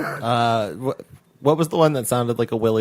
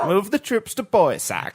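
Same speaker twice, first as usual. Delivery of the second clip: bad British accent, a bit slow; 5 semitones higher, slower than usual. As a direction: try a British accent and miss. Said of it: "I can't do a British accent".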